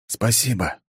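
usual speaking voice

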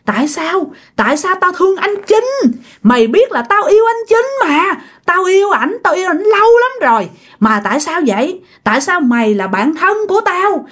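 high-pitched, wailing demands to know why; acted jilted spirit's tirade; scolding accusation. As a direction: berate a friend who betrayed you, pitch climbing sharply. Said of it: "Vừa liếc vừa chửi vừa chỉ nha".

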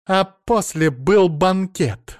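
slow, high, loud cry; shouted accusation. A narrator's reading. Mother-in-law accuses him over the phone, slow indignant shout. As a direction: shout it out word by word. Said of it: "закричала она".

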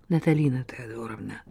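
whispered, begging plea; pleading whisper. Acted. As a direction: beg her in a whisper. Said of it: "взмолилась Глафира".

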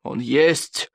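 stubborn, restrained insistence; measured contradiction, patience wearing thin; strained patience. A narrator's reading. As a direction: hold your temper while insisting. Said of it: "упорствовал Норберт, с трудом сохраняя терпение".

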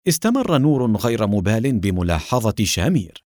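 usual speaking voice

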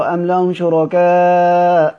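Quranic recitation. Shown as a demonstration. Teacher quoting a verse to students, slow recited chant. Quran words quoted in lesson.

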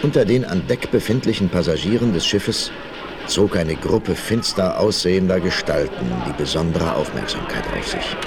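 usual speaking voice

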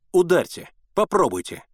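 narrated defiant outburst; defiant dare. A narrator's reading. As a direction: dare him to strike. Boy dares the boatman.

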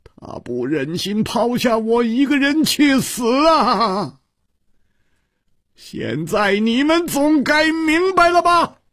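anguished high-pitched cry; desperate strained yelling; hoarse shouting. A narrator's reading. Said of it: "嘶着声音喊道".

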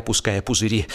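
usual speaking voice

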